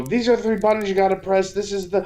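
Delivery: rapid-fire instructions; fast, businesslike patter; rushed briefing. Retold or imitated. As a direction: rattle it off like a hurried director. Director briefing the stand-in hurriedly.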